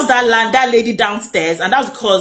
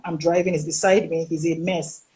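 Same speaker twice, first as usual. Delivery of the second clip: low, hushed warning, softer toward the middle; retold whisper; 5 semitones lower, 5 dB quieter than usual. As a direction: imitate a friend secretly tipping you off. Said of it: "he was whispering".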